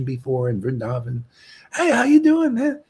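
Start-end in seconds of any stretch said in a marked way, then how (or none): 1.7-2.8 high, loud mock hail to an old buddy; friendly greeting. Preacher greeting an old friend in Vrindavan, high eager hail. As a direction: call out brightly, retelling a hello.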